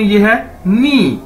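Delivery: नी drawn out very slowly; Chinese word nǐ. Shown as a demonstration. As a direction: stretch नी for the students to hear. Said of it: "इसकी pronunciation". (नी को बहुत धीरे खींच कर)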